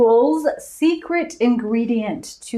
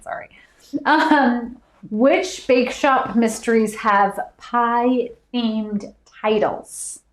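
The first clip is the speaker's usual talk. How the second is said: ordinary delivery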